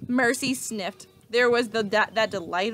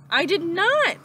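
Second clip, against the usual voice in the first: indignant denial; 10 semitones higher, 7 dB louder than usual. A narrator's reading. Sisters bicker over pie.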